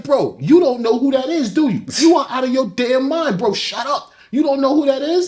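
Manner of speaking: high, exasperated disbelief at a friend; alarmed scolding. Retold or imitated.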